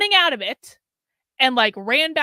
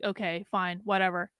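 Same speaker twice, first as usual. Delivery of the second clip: much softer, giving up; resigned brush-off; 4 semitones lower, 8 dB quieter than usual. Retold or imitated. Woman brushing off her boyfriend's cruel remark, soft and resigned. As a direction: say it like you're too tired to care.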